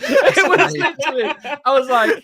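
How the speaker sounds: high and loud through laughter; talking while cracking up; laughing. Real, not acted. Laughing retelling coaster loop.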